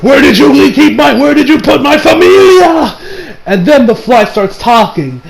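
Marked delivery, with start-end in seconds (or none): 0.0-3.0 high, loud crazed demand; over-the-top anime outrage; hammed-up frenzy. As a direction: shout it with mock fury, stretching the end. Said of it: "he just goes crazy with Zipperman".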